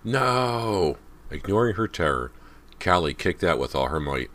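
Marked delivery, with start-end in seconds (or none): 0.0-1.0 high, loud drawn-out no; terrified cry. A narrator's reading. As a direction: cry out in sudden terror. Heroine cries out in terror.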